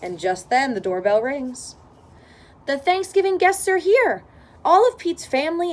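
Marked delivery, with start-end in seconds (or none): 2.7-5.7 excited. A narrator's reading. Guests arrive at door.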